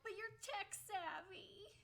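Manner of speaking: high slow mock plea from the grandparents. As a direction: mimic relatives begging for tech help. Voicing grandparents pleading.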